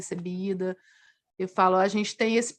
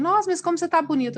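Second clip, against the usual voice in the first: gushing compliment; 8 semitones higher, 4 dB louder, much faster than usual. Retold or imitated. People complimenting her looks.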